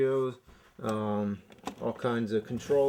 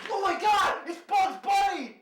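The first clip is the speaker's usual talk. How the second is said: high excited shout. Acted. Repeated high Bugs Bunny exclamation.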